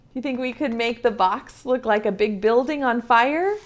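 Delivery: plain reading voice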